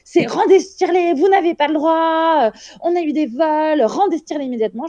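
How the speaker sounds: high-pitched scolding demand, quickening to fast at the end; bossy nurse. Retold or imitated. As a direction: order her to hand it back now. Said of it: "en disant".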